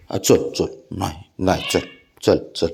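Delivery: very low, soft little urgings; soft coaxing. Real, not acted. Owner nudging the worried pet to come and look, soft coaxing. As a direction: coax gently in a low, hushed voice.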